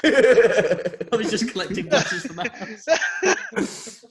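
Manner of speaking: giggling admission; laughing. Real, not acted. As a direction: laugh between the words. Laughing, slowed.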